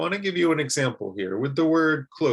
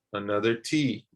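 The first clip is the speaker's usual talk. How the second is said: ordinary talk, nothing marked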